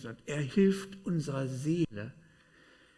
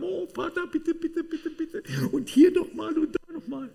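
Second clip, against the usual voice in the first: very high, squeaky pleading; mock begging; 8 dB louder than usual. Preacher mimics people praying to the Father, high mock begging. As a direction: plead like a pestering child, hammed up.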